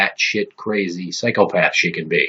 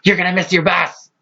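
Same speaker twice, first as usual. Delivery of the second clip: mock nagging mom; 9 semitones higher than usual.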